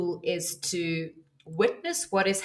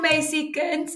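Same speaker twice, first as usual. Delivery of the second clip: put-on slow, much higher Afrikaans-accented old man; South African accent; 9 semitones higher than usual. Imitating the burly old man calling her over, slow South African accent. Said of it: "speaking in his accent".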